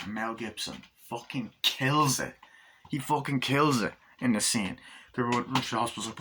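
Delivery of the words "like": ordinary talk, nothing marked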